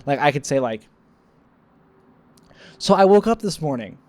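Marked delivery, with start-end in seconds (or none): 2.8-4.0 higher, louder deadpan setup; louder mock anecdote opener; mock storyteller opener. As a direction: start a joke then stop.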